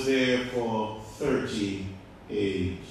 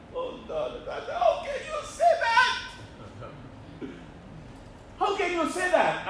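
mock outrage. Mock outraged listener protest.

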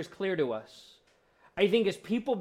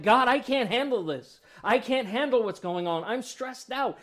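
louder, higher mock desperate plea; desperate cry; 6 semitones higher, 4 dB louder than usual. Praying person pouring out stress to God, raw and louder. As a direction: blurt it out to God, overwhelmed. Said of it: "if you're like".

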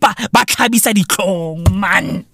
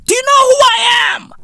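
entitled scammer; 12 semitones higher than usual. Retold or imitated. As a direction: play a pompous big shot shouting his status. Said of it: "then going".

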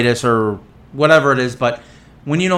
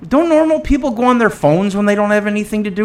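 higher, exasperated scolding of the staff; exasperated mock rant; 8 semitones higher than usual. Patient scolding staff who keep watching him, exasperated. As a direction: roll your eyes and scold them.

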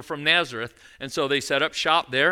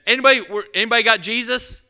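louder, higher call through the crowd; 6 semitones higher, 6 dB louder, faster than usual. Retold or imitated. Parents calling through the crowd for their missing son, louder and higher. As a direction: shout to the whole group.

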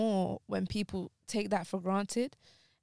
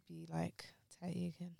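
quiet, choked; 11 dB quieter than usual. Real, not acted. Grieving, voice drops.

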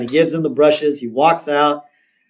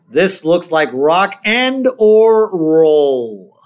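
very slow, square minister voice; higher, drawn-out put-on preacher; Reverend Lovejoy; 4 semitones higher, 4 dB louder, much slower than usual. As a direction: stretch each word out in stuffy suspicion. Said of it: "this is so square".

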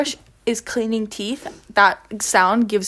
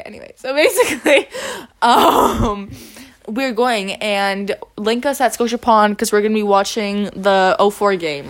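ordinary delivery

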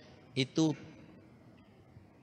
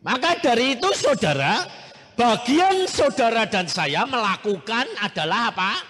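full-force preaching; 10 semitones higher, 10 dB louder than usual. Acted. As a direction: thunder it from the pulpit.

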